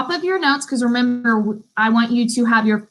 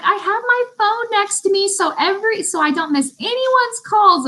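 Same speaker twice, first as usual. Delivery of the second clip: high sweet voice; 10 semitones higher than usual. Retold or imitated. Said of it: "She was like".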